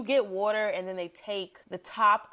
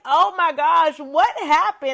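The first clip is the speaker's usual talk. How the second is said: louder, high exclaiming friend; loud excited exclaim; 7 semitones higher, 9 dB louder than usual. Retold or imitated. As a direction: burst out loud like a shocked friend.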